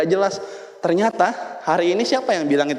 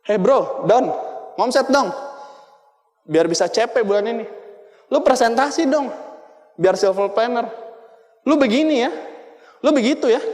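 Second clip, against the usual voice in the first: lightly voiced bossy upline, a bit higher; pushy upline; 5 semitones higher than usual. Upline lightly voiced nagging his downline to sell more. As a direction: nag a downline like a pushy boss. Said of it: "sering ngomong".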